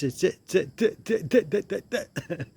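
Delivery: laughing through a mock stutter. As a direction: stammer out the repeats, half laughing. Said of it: "he used to stutter".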